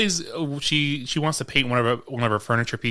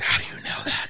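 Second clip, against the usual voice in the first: whispered; 7 dB quieter than usual. Retold or imitated. Kelly asking, retold.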